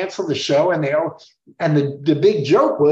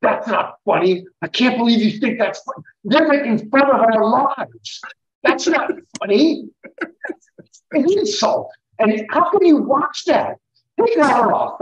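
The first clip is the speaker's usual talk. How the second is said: higher, louder offended scolding; mock outrage; 6 semitones higher, 4 dB louder than usual. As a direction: scold the band like it's a personal insult. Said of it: "he'd lose it".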